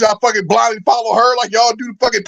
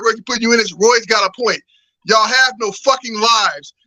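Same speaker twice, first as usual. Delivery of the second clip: usual speaking voice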